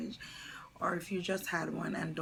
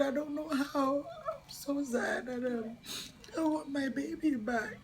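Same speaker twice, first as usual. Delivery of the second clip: high, breaking voice, weeping; crying; 9 semitones higher than usual. Real, not acted. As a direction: speak through real tears. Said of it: "And I said".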